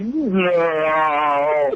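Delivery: long drawn-out mock "no", sliding down; imitating a slowed-down cry of horror; slow-motion shout. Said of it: "In, like, slow motion".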